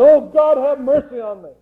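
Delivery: a man on his knees begging for mercy; crying out to God. Retold or imitated. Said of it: "fell down on his knees and cried".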